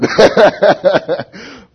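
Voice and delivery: short burst of loud, high laughter; high, hearty real laugh. Real, not acted. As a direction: crack up loudly at your own joke. Laughs at his own joke.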